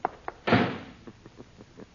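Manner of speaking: breathy, pleased laughter; amused laugh. Acted. [name] laughs after his snake joke, breathy. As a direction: laugh off the trick. I could not resist.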